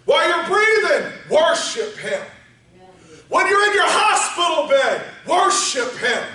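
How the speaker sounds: high, loud call and refrain; preaching at full shout. Acted. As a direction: shout each call, drop on worship him. Repeated shouted exhortation.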